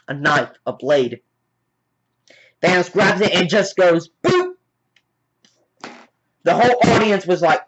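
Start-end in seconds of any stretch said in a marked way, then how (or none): 4.2-4.6 short high-pitched "boop"; little high pop of the voice; boop sound effect. Acted. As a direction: imitate a comic hit. Imitated blow after "just goes.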